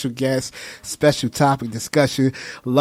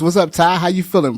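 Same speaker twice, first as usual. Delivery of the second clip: ordinary talk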